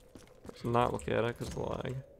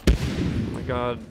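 slow, loud oh my god; drawn-out loud exclaim; 7 dB louder, much slower than usual. Real, not acted. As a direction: stretch it out loudly in disbelief. Deafening bomb sound.

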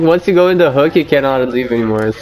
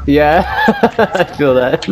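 slow words through laughter; laughing; 4 semitones higher, much slower than usual. Real, not acted. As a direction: laugh while agreeing. Laughing at teammate's death.

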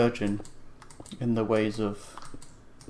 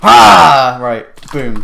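loud gleeful ha; triumphant shout; 3 semitones higher, 20 dB louder, much slower than usual. Real, not acted. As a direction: bark out a victory laugh. Triumphant kill in game.

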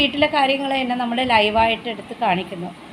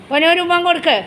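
higher, louder sing-song coaxing; 6 semitones higher, 5 dB louder, much faster than usual. Real, not acted. Mother coaxing her pet for a kiss, sing-song. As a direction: coax the little one sweetly for a kiss.

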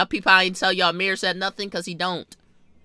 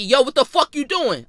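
brother confronting; 6 semitones higher, 4 dB louder than usual. Retold or imitated.

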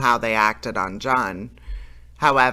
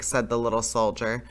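ordinary delivery

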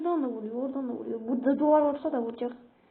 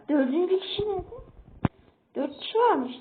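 usual speaking voice

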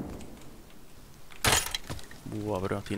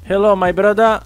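high, loud friendly hail; calling out; 12 semitones higher, 8 dB louder, slower than usual. Acted. Greeting the neighbor over voice chat, high and loud. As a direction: wave someone down from afar.

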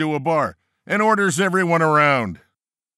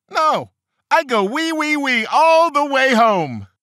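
high, loud, drawn-out piggy squeal; hammed-up pig voice, pitched way up; 11 semitones higher, 5 dB louder, slower than usual. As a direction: squeal it out slowly, stretching the punch line. Pig's nursery-rhyme punch line, acted.

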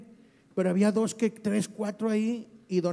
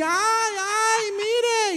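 mock alarmed cry; 10 semitones higher, much slower than usual. Woman cries out to guard.

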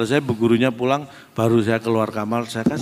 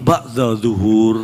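slow drawn out; 4 semitones lower, much slower than usual. Acted. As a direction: say each word slowly, deadpan. Jawaban lucu versi pesantren. (ucapkan pelan dan datar)

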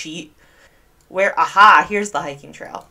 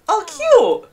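high, loud burst of delight; delighted squeal; 14 semitones higher, 6 dB louder than usual. Real, not acted. Player spots cutie pies in the game, delighted high squeal. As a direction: squeal over something adorable.

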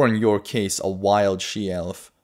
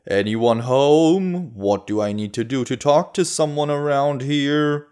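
loud, higher taunting shout; yelling; 5 semitones higher, 4 dB louder than usual. A narrator's reading. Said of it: "the man yells".

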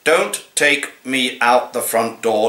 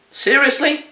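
incredulous; 14 semitones higher than usual. Acted. Cat poem, incredulous outburst.

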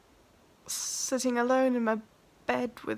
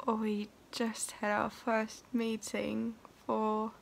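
slow hushed whisper; 5 dB quieter, slower than usual. Real, not acted. Telling viewers about the first meeting, slow whisper. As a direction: share it slowly in a whisper. Confiding news to camera.

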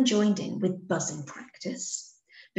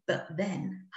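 ordinary delivery, nothing marked

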